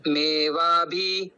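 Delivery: मेवा भी very slow, short इ in भी clipped; slow demonstration. Shown as a demonstration. Teacher repeats the phrase slowly. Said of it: "भ के ऊपर छोटा इकार है".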